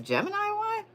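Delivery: high, puzzled squeak of a question; mock confused viewer. Imitating Geminis baffled by their reading, high squeak. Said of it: "y'all are like".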